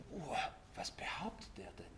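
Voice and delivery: whispered gasp of outrage, Uah; hushed scandalized murmur; mock horrified whisper; shocked whisper.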